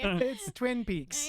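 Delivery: slow, eerie Red Room reverse talk; backwards speech. Pretend. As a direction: drag it out like reversed tape. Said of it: "Are you in the Red Room?".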